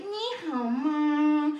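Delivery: slow, loud whiny nǐ hǎo ma; whiny Mandarin. Retold or imitated. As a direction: drag it out in a whine. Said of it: "if it sounds like whine".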